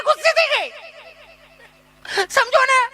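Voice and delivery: high, affected shocked city lady; mincing posh tourist. Retold or imitated. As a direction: gasp it out like a pampered lady. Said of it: "મને કે". (ચીપી ચીપીને નવાઈથી)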